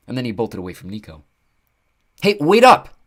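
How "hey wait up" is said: loud, high call after someone running off. A narrator's reading. Nico shouting after the fleeing centaur, loud and high. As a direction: call out so he stops. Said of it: "Nico called out".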